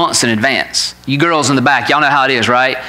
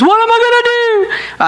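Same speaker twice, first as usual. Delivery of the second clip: much higher wailing imitation of a sobbing girl; imitating crying. Father imitating his daughter crying over ripped pants, high wail. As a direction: wail it like a panicked teen in tears. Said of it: "she looks at me and she says".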